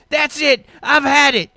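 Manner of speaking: high, loud exasperated yell; angry outburst; fed-up Lester. Acted. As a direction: snap and shout at the end of patience. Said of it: "he says".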